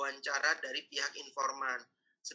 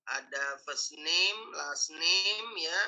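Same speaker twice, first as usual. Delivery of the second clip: slow dictation; much slower than usual. Real, not acted. Dictating form fields slowly.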